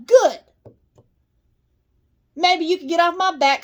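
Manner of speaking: high, loud sharp retort; pointed angry snap. Real, not acted. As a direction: bite the word off in anger. Snapping angrily at pets.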